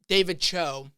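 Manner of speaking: ordinary talk, nothing marked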